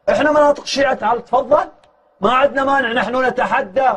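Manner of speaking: high-pitched, driving pulpit challenge; fiery preaching. Acted. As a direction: preach it hard, daring the opponents.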